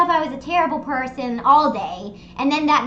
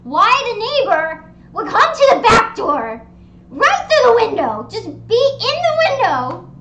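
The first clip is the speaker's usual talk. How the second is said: loud, high-pitched disbelief; exasperated rant; 7 semitones higher, 7 dB louder than usual. Real, not acted. Storyteller protesting a neighbor at the back door, exasperated rant. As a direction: rant it at full volume, incredulous.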